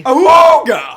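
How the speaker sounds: loud, high awooga cry; awooga call. Acted. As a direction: blast it out like a cartoon horn. Mock pickup lecture climax.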